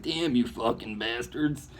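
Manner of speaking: slow, soft mock grumble at betrayers; amused, half-hearted cursing; mock curse. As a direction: shrug it off with a lazy mock curse. Laughing off a betrayal.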